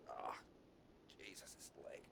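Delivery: whispered groan of pain. Real, not acted. Injured [name] whispering about his aching leg. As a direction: hiss it through the hurt.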